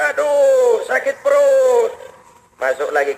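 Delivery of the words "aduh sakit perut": slow, strained moan, stretching perut; overfed man moaning, slow and pained; groaning in pain. Acted. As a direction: whimper it like the belly is about to burst. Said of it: "beliau dengar lagi orang ngerintih kayak tadi".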